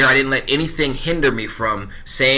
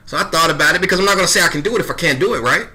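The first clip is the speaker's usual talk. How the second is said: ordinary talk, nothing marked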